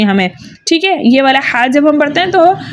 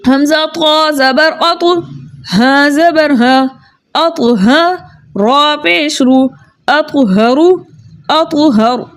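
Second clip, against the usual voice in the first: slow, drawn-out spelling recitation; practice recitation; slower than usual. Shown as a demonstration. Teacher leading students in practice. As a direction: recite each syllable deliberately. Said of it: "आइए मश्क कर लेते हैं".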